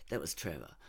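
ordinary delivery, nothing marked

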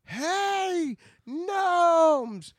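loud high shout to his gnomes; calling out. Acted. Roquat shouting for his gnome army, loud and high. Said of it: "he's like".

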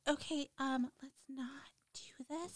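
barely-there breathy whisper; timid whisper. Retold or imitated. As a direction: whisper it too softly to be heard. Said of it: "She just goes".

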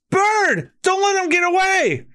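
high urgent yell; high shout. Acted. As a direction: shout the order after him. Luffy shouting.

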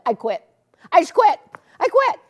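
building from firm to high, loud outcry; dramatic quitting. Retold or imitated. Retelling how she quit to Oral, escalating to a loud high cry. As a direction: quit in a rising dramatic burst. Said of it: "something that dramatic or drastic".

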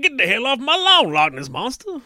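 high, loud hammed-up yell; loud, high mock yell at a monster; mock shout. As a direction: bellow it at the monster like a cranky homeowner. Shouts cartoon line at monster.

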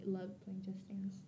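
unvoiced trailing whisper. Real, not acted. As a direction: drop to a whisper at the end.

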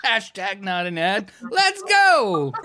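high, loud announcer-style hype; mock hype. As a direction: pump it up like a fake sponsor plug. Hyping a casino trip.

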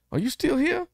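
very high-pitched mock movie-character voice; high, put-on question; Doc Holliday. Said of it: "Doc Holliday said".

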